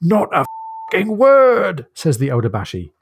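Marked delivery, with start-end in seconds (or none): 0.0-1.8 slow, higher Cockney put-down; a light Cockney accent, very slow; Cockney deadpan. Retold or imitated. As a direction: drag each word out in Cockney. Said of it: "like a Cockney, twang to his dialect".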